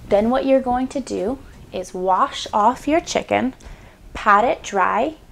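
normal talking voice

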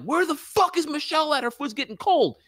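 high-pitched mock cook yelling for the waiter. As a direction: squeal it out like an impatient cook. Cooks buzzing the waiter.

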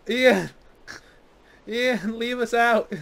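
calling out loud; shouting. Real, not acted. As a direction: yell it across at him. Yelling at another player.

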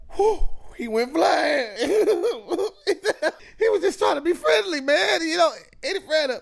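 real laughing fit, words squeezed out between laughs; laughing so hard the voice cracks up high; helpless laughter. Real, not acted. Can't stop laughing at the clip.

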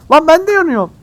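high loud frantic cry; panicked yell. Real, not acted. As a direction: shout in alarm. Panicking on fire in game.

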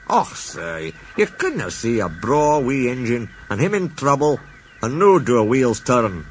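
Douglas shrugs off his good deed; Scottish engine. Acted. Douglas modestly answers the Fat Controller, in a Scottish voice.

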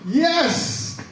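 high shouted yes; excited crowd. Retold or imitated. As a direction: cheer like an eager crowd. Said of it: "all the Israelites are going".

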